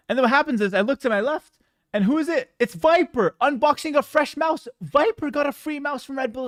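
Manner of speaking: rising indignant storytelling; worked-up disbelief. Real, not acted. Discovering rival got free gear.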